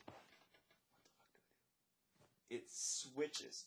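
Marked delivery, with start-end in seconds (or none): 0.1-2.6 whispered panicked thought; inner thought. Retold or imitated. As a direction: whisper it as if only to yourself. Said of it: "in my head, I'm like".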